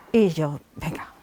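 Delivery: ordinary talk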